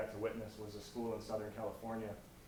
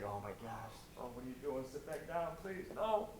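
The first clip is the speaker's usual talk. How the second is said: mock embarrassed crowd; 4 semitones higher than usual. Students mocking the girl at the mic.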